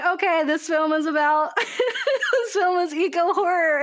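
half-laughing, words breaking up with laughter; giggling as it clicks; laughing realization. Real, not acted. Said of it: "laugh cry".

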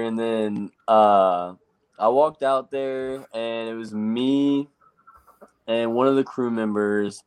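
usual speaking voice

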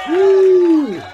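high loud crowd cheer; mock applause cheer. Shown as a demonstration. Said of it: "that's long as hell".

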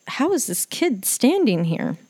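freaking out. Retold or imitated. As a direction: act out freaked-out disbelief. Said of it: "I was freaking out because".

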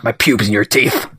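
low, put-on movie voice; film quote voice. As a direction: growl out a film line. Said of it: "That's from the film".

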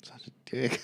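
soft whisper; whispered aside. Retold or imitated. Coworker's whispered dig at the boss. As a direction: whisper it behind his back.

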